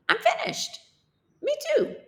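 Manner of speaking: squealing high and happy; two kids shout they are finished, very high; high excited voice. Acted. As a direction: cheer that you're done. Characters cheer finishing.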